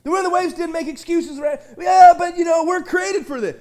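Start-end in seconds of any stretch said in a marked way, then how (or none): 1.8-3.6 mock excuse.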